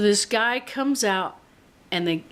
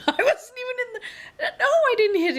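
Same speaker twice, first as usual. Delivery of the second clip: higher, fast words through laughter; laughing; faster than usual. Real, not acted. Denying she hit anything, laughing through the words. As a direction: laugh while protesting.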